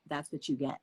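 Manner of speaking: a low, quiet gruff dad; deadpan dad, serves you right; imitating a dry, unimpressed father. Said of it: "my dad was like".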